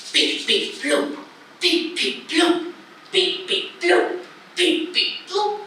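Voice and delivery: high-pitched robotic beeps and bloops; short staccato machine noises to amuse a baby; trained monkey act. Pretend. Mama making baby laugh, playful machine beeps. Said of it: "Mama acting like a trained monkey".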